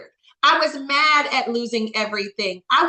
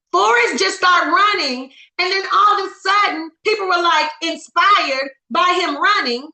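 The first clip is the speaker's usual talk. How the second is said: rising, louder storytelling push; excited build; 5 semitones higher, 5 dB louder than usual. Real, not acted. Speaker to Zoom team, telling Forrest Gump with an excited build. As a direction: lift the room as the story builds.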